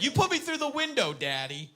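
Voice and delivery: put-on high-pitched throaty child accusation; throaty little girl. Daughter to dad he is challenging, high throaty protest. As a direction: imitate a small girl accusing her dad. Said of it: "she gets a little throaty".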